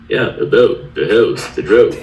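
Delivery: imitated Canadian accent, vowels played up; put-on Canadian accent. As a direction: show off a learned Canadian accent. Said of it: "try to improve my accent".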